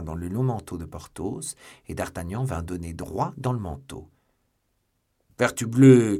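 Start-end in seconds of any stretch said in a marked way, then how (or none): 5.4-6.2 very loud, startled bellow; big, loud cry of annoyance; shouted oath. A narrator's reading. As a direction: bellow the oath as someone crashes into you. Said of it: "cria Porthos".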